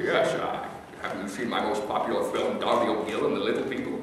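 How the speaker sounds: lightly voiced aging Scot with a cigar-and-whiskey rasp; Sean Connery impression. Telling a crowd about meeting Sean, a light Scottish star impression. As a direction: do a soft, lazy old Scottish film star. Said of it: "his voice has never changed".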